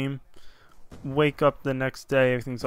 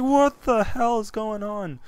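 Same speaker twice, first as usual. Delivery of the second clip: much higher, slowed, bewildered cry; shocked disbelief; 8 semitones higher, 4 dB louder, slower than usual. Retold or imitated. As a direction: let the confusion stretch each word. Ghost vanished from mirror.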